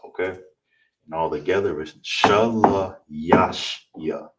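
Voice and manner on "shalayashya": the whole phrase read slowly, with shalayashya stretched out; slow full read. Shown as a demonstration. Teacher blends the syllables into one word, slowly. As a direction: slowly put the syllables back together. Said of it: "Here's the breakdown".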